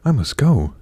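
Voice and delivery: urgent storybook exclamation; urgent leave-taking. A narrator's reading. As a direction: insist that you have to leave. Cinderella fleeing as midnight strikes.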